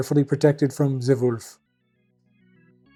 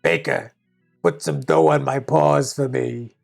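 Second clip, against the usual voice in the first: wolf voice; 2 semitones lower than usual. Acted. Wolf orders the baker.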